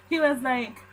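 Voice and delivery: ordinary talk, nothing marked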